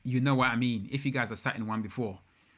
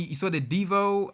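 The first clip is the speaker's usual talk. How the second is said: ordinary talk, nothing marked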